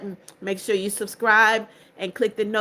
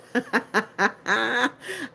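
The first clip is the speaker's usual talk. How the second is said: a real, crow-like laugh; cawing laugh. Real, not acted. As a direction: laugh like a crow, caught off guard. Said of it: "you sounded like a crow".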